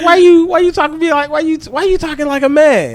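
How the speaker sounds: high-pitched kid impression, questioning; little son. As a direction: mimic a puzzled little boy talking to dad. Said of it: "he like".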